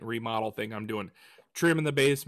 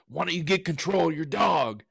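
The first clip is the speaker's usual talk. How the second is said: grumpy stranger; 5 semitones higher than usual. Retold or imitated. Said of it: "he goes".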